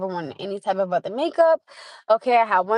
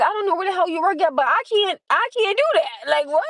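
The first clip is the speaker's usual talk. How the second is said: higher, exasperated retort; mock outraged complaint, raised pitch; incredulous; 8 semitones higher than usual. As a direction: push it up high, fed up and baffled. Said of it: "I'm like".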